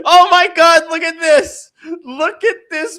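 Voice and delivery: shocked outburst. Real, not acted. Co-host stunned by the UFC and FBI logos, loud and high. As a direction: blurt it out stunned, pitch jumping up. Shocked at back-to-back logo image.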